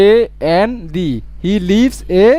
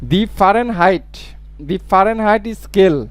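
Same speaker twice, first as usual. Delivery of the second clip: slow dictation; much slower than usual. Shown as a demonstration. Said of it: "teacher reads example slowly".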